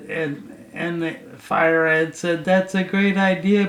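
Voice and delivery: usual speaking voice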